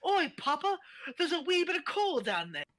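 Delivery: put-on high, loud little Scottish boy; high-pitched Scottish child calling to Papa; Scottish kid. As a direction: pipe up as a wee Scottish coal-mine lad. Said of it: "turned fucking Scottish".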